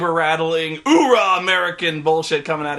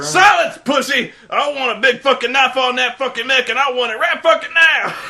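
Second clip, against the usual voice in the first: higher, louder barking orders; hammed-up colonel yell; 7 semitones higher, 5 dB louder than usual. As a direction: bark it like a crazed Marine commander. Colonel yelling at soldier.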